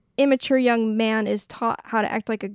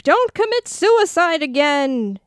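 higher, drawn-out mock exclamation; mock urgent plea; 6 semitones higher, 4 dB louder, slower than usual. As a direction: blurt it out slowly, hammed-up and pleading. Blurting out a character's tactless line.

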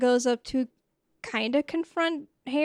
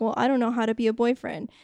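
quick mock excuse; much faster than usual. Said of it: "he's like".

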